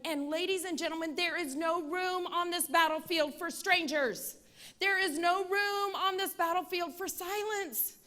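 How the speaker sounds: rally the room, build it up; rising rallying cry. Real, not acted. Speech builds to a rallying close.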